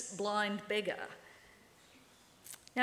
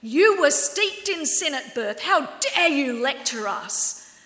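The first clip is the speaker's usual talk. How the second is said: loud, high angry shout, slower at the end; furious outburst; 4 semitones higher, 11 dB louder than usual. Acted. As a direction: shout him down in outrage. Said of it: "To this they replied".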